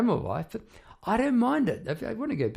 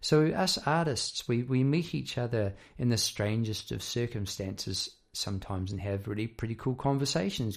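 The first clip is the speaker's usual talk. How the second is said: ordinary delivery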